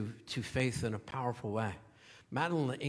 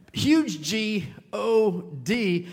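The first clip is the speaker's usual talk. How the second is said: G O D spelled letter by letter, slow and louder; spelled out, emphatic; 6 semitones higher, 8 dB louder, much slower than usual. Retold or imitated. Pastor picturing the huge truck letters, slow and emphatic. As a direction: spell G O D out slowly, each letter punched.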